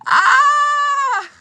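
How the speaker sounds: sharp high cry of pain; real shout of pain, loud and high. Real, not acted. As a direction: yelp as the wound stings.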